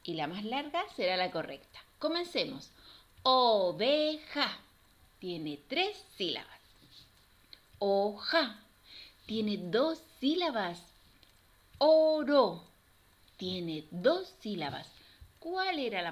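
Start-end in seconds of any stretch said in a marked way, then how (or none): 3.2-4.6 clap-out pace, "Oveja" broken in three beats; syllable by syllable. Shown as a demonstration. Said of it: "vamos a separar cada una". 7.8-8.6 "Ho-ja", a clear gap between syllables. Shown as a demonstration. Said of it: "vamos a separar cada una". 11.8-12.6 loud, separated "O-ro"; teacher counting out the syllables of "Oro". Shown as a demonstration. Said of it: "vamos a separar cada una".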